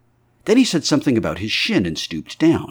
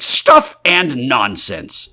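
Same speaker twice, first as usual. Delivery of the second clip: scoffing; 4 semitones higher, 6 dB louder, much slower than usual. Acted. Invisible man scoffs.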